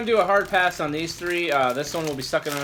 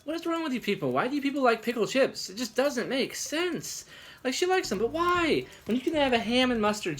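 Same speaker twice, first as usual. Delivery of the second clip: exasperated; 7 semitones higher than usual. Real, not acted. Ranting about pickle chip fans.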